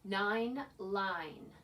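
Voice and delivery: usual speaking voice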